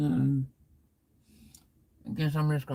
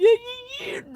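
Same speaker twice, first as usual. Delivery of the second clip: high cracking shout; hammed-up screaming insistence; mock shout; 6 dB louder than usual. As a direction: yell it like the argument is lost. Acted egg quarrel climax.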